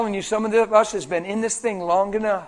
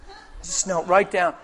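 usual speaking voice

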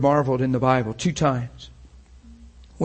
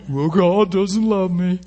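slow, raised mock-wounded whine; dejected self-pity; 4 semitones higher, slower than usual. A rejected believer gives up on God, dejected. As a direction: draw it out, pitched up, feeling sorry for yourself.

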